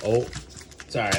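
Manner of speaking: usual speaking voice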